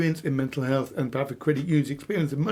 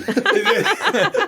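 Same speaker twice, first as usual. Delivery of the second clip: high, loud burst of laughter; a sudden delighted laugh; 5 dB louder than usual. Real, not acted. Host laughing that the guest is doing his script.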